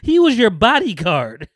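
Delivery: high and loud exasperation; emphatic disbelief. Real, not acted. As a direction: hammer it home slowly, incredulous. Repeats point in loud disbelief.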